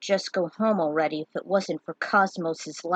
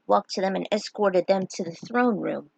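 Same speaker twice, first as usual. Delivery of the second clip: plain reading voice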